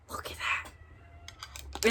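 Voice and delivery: real whisper to herself; hushed admiration. Real, not acted. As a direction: whisper it slowly, soft and admiring.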